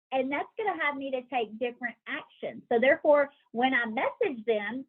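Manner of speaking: ordinary talk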